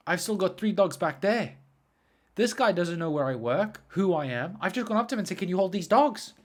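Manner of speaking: loud, high-pitched mock freak-out; retold panic. Dog walker remembering dogs left with a stranger, retold panic. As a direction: act out the rising alarm, voice pushed up. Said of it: "And I am freaking out".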